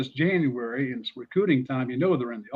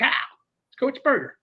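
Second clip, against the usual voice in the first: much higher phone greeting; Spurrier impression; 10 semitones higher than usual. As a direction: announce yourself on the phone, high. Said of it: "And he said".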